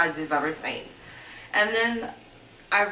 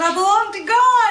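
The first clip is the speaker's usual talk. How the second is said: much higher, much louder desperate cry; retold crying scream; 12 semitones higher, 9 dB louder than usual. Her screaming back at the abuser, crying and loud. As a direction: scream it out through tears. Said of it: "I was crying and screaming".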